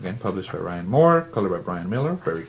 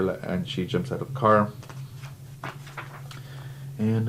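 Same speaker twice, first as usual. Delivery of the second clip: usual speaking voice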